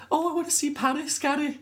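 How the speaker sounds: very high falsetto squeak; high squeaky voice. Retold or imitated. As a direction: squeak it like a tiny cartoon. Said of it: "it's like".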